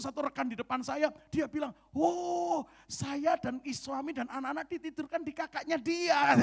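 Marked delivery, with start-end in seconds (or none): 1.9-6.3 excited reply. Retold or imitated. Colleague's reply retold at dinner.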